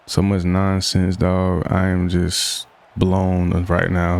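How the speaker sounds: ordinary talk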